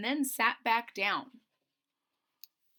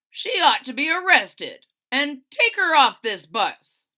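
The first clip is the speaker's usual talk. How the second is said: loud, higher demand for arrest; angry crowd shout; 6 semitones higher, 13 dB louder than usual. Acted. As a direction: shout it like an outraged passenger. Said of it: "were saying aloud".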